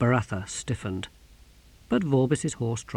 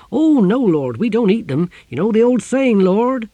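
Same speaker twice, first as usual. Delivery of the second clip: loud, raised-pitch hearty sailor; eager sea captain; 10 semitones higher, 8 dB louder than usual. A narrator's reading. Captain cheerfully correcting the Exquisitor, loud and eager. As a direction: chatter on loud and high, heedless of danger.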